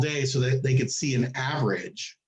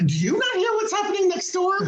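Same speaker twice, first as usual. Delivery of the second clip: high, incredulous mock question; sarcastic high voice asking the nurse about next door; exasperated sarcasm. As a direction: needle the nurse with disbelief. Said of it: "she goes".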